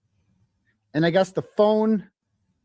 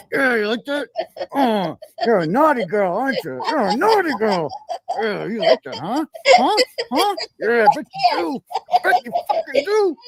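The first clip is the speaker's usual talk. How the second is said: mock dirty talk; 5 semitones higher than usual. Acting out doll sex scene.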